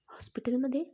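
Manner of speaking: ordinary delivery, nothing marked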